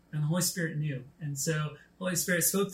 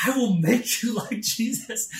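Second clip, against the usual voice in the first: higher and a bit louder, laughing through it; mock frustrated threat; 6 semitones higher, 4 dB louder than usual. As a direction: play your old exasperated self, half joking. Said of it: "I was just frustrated".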